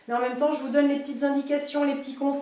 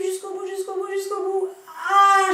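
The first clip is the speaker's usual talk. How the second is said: straining with effort; 4 semitones higher, 3 dB louder than usual. Real, not acted. Straining through a hard rep.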